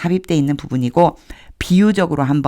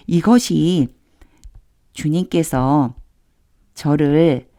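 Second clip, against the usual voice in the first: ordinary talk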